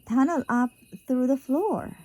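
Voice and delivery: usual speaking voice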